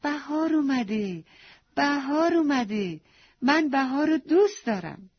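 put-on little girl cries out happily on seeing the first blossom; joyful shout. A narrator's reading. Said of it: "با دیدن اولین شکوفه فریاد زد".

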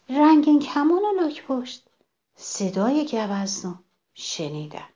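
ordinary delivery, nothing marked

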